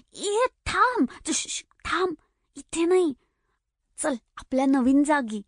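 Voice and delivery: high, hushed-urgent "stop, not here"; urgent cry. Acted. Mahelka urgently stops Upapa from talking in the open. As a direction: stop him quickly, pitched high and urgent. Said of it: "माहेलका ओरडली".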